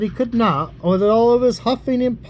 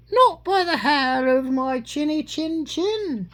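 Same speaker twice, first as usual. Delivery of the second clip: squeaky little cat; 8 semitones higher, slower than usual. Acted. Little cat answers the wolf.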